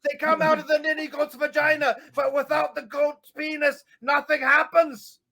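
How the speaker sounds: high, loud mock tirade; hammed-up angry rant. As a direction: rant like an enraged caricature.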